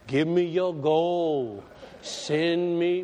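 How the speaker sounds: slow, drawn-out plea for money; mock televangelist. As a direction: stretch each word like a TV preacher fundraising. Mimics money-seeking TV preacher.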